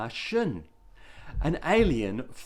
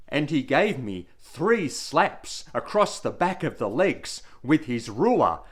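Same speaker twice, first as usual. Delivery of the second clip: plain reading voice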